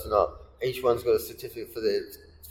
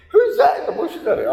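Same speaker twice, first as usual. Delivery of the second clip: a high, louder put-on woman's voice; hammed-up girlfriend asking, pitched high; mock woman's voice; 5 dB louder than usual. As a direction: squeak it out like a startled girlfriend. Woman voiced in retold story.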